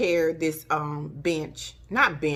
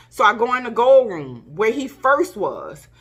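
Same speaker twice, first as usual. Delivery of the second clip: ordinary talk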